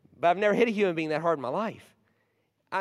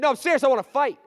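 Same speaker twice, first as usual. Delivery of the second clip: insistent bully; 10 semitones higher, 9 dB louder, faster than usual. Retold or imitated.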